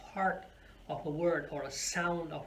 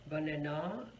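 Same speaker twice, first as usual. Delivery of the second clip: "banana" as ba-na-NA, rising at the end; stress on last syllable; 2 semitones lower than usual. Shown as a demonstration. Teacher asks which stress is correct, last stressed. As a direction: push the final syllable of "banana". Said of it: "where are we going to put the stress".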